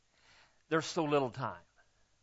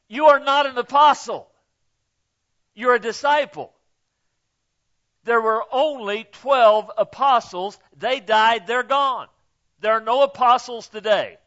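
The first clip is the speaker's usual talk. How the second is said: higher, louder insistent preaching; preaching at full force; 6 semitones higher, 8 dB louder than usual. Real, not acted. Preacher telling the congregation there are no apostles, loud. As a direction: drive it home to the pews.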